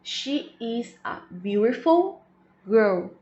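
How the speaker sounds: slow model sentence. Shown as a demonstration. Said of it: "Um exemplo aqui".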